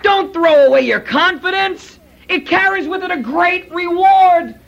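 high, loud rallying cry; preacher urging listeners to hold on; urgent exhortation. Shown as a demonstration. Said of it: "Do you see the tone of the letter".

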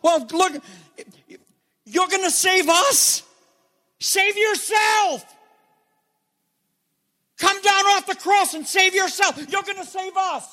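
high, jeering mock voice; mocking crowd. Preacher voicing the mockers at the cross, jeering high. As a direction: sneer at him like the crowd at the cross. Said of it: "What did they say".

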